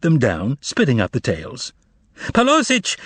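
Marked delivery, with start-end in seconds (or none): 2.3-3.0 high, desperate call; desperate cry. A narrator's reading. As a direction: shout it in despair. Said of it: "another desperate cry".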